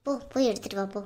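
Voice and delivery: mother hushes her daughter off to get the ring, quietly; hushed urging. Acted.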